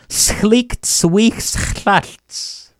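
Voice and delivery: very slow, carefully spelled-out phrase; slow teaching model. Shown as a demonstration. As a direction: say it slowly, every sound clear. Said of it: "This one he could almost chalk up as".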